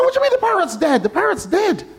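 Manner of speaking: very high, fast, stunned outburst; shocked disbelief. Retold or imitated. Pet store owner hearing the parrot died, high and stunned. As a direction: shriek the disbelief. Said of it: "He said".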